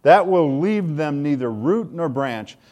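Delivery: loud, high, fervent preaching; preaching at full force. Acted. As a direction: proclaim it like thunder from the pulpit.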